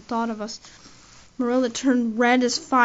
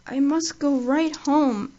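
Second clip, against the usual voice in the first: woozy; 4 semitones higher, 4 dB louder, slower than usual. Acted. Tipsy Diana insists on leaving.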